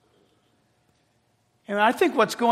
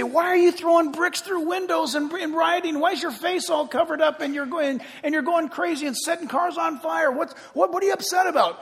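high-pitched, incredulous questioning; mock street interviewer; 8 semitones higher than usual. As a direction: fire off questions like an exasperated street reporter. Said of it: "go out in the street and say".

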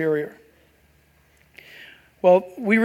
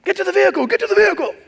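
much higher, hurried call to the truck; urgent storm chaser; 9 semitones higher, much faster than usual. Retold or imitated. Preacher mimics storm chaser yelling to get in, urgent call. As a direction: bark it like a chaser rushing to cover. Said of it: "those storm chaser guys".

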